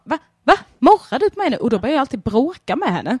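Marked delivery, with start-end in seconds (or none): 0.0-1.6 high, loud mock indignant questions; mock outrage. Owner scolding her growling cat, high and mock indignant. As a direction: act offended at the cat. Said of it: "jag bara".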